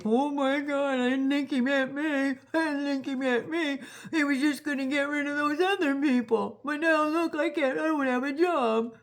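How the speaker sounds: high mocking whine of a laid-off supporter; whiny maga voter. Mocking laid-off maga voters who didn't think it meant them, high whine. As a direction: lift the voice into a pathetic dismayed whine.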